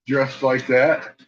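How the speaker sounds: ordinary talk, nothing marked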